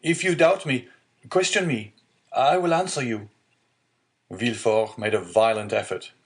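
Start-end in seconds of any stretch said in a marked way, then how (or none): 0.0-3.3 much higher, louder pleading outcry; desperate cry. A narrator's reading. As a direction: throw yourself on his mercy, crying out high. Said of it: "cried the unhappy young man".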